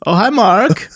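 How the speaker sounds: high Wiseau greeting; Wiseau line. Retold or imitated. Quoting The Room.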